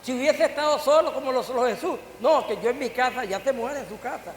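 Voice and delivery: loud preaching. Acted. Preacher warns the congregation what loneliness means, loud and emphatic. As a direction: hammer home the point from the pulpit. Preacher stresses need for community.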